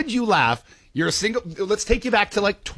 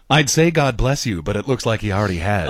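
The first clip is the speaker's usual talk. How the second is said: pickup-line voice; 7 semitones lower than usual. Acted. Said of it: "why do I do it in that voice".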